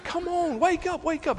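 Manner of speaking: high, impatient "come on, wake up"; exasperated mock outburst. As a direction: snap at the page as if the people could hear. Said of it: "for a minute I get mad at it".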